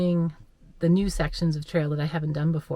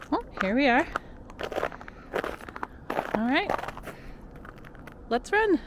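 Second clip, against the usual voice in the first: excited; 9 semitones higher than usual. Real, not acted. Setting off on a run.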